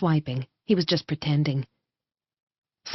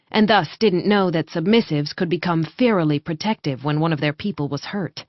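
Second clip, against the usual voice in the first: ordinary delivery, nothing marked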